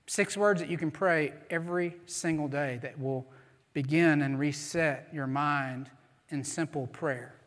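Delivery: normal talking voice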